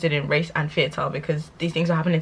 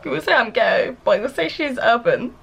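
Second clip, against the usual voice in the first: ordinary talk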